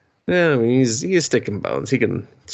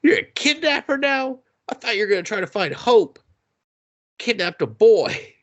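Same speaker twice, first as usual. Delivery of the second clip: high-pitched, incredulous retold accusation; mock indignation; 13 semitones higher than usual. Son confronting his kidnapper dad, high mock indignation. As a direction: imitate a disbelieving son.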